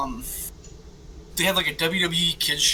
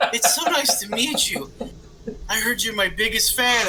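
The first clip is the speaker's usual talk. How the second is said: higher, over-friendly voice; a sugary, higher mock greeting; mock-sweet greeting; 7 semitones higher than usual. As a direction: fawn over the imaginary kid. Talking to imaginary Little Jimmy.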